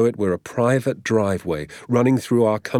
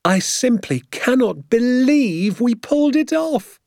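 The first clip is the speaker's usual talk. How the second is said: very high, slow, giddy amazement; elated disbelief; 3 dB louder, slower than usual. A narrator's reading. Father amazed they pulled it off, elated disbelief. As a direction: marvel in a high, drawn-out voice.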